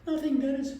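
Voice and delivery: high, timid acted reply; meek. Employee answering his angry boss, high and meek. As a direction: squeak it out as a cowed underling.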